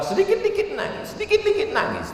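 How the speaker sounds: high, soft mock whimper; mock weepy. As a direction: imitate someone tearing up easily, high and soft. Meniru orang gampang menangis. (pura-pura merengek mau menangis)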